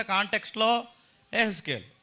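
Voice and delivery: normal talking voice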